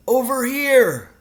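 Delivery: high, loud, drawn-out call. A narrator's reading. Hidden father guides his son through the wood, calling out. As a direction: stretch the call to carry far. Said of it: "the voice called out".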